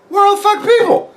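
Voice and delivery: very high, squawking disbelief; mock outrage. Asking coworker where the workers are, high squawking disbelief. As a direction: squawk the question in exaggerated shock. Retells shocked question to coworker.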